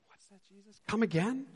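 high, incredulous question; playfully baffled; a touch of mock surprise; mock disbelief.

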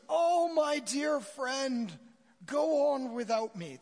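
slow, high, loud theatrical cry with a bad put-on accent; hammed-up drowning man crying out; melodramatic plea. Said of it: "it's really quite melodramatic when he says".

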